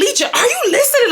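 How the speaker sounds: very high, fast exasperated question; retold exasperated demand. Angry woman to her deflecting ex, exasperated demand. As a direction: pitch it way up, fed up and rushed. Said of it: "I'm getting so mad".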